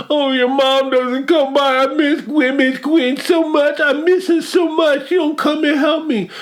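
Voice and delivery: high, mocking whine, near a shout at the end; hammed-up high pleading whine; mock whiny complaint. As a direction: parody a new mom suddenly missing her mother-in-law. Mocking Yara's whining.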